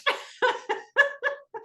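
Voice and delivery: squealing high laugh; high delighted giggle. Real, not acted. Commentator giggling at the couple's fight. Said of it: "I love this fight".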